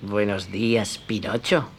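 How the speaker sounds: low, soft, sly fox voice; a hushed, crafty greeting. Acted. The fox greets Pinocchio, low, soft and sly.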